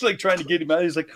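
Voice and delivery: usual speaking voice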